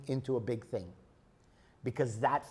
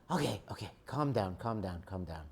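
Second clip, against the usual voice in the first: lower, softer soothing self-talk; calming oneself; 7 semitones lower, 7 dB quieter than usual. Pretend. Person calming their own outburst, lower and softer. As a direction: talk yourself down gently.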